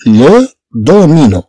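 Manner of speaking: "لو دومينو" said slowly as one word; slow whole word. Shown as a demonstration. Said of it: "الكلمة ككل".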